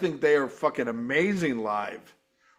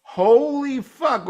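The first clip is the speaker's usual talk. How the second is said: amazed exclamation; 7 semitones higher, 4 dB louder, slower than usual. Retold or imitated. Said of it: "I was like".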